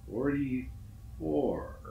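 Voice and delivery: very slow, drawn-out and hollow; dawning dread. Acted. Climber on the stairs realizing the floor repeats, slow dread. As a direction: let the number sink as the horror dawns.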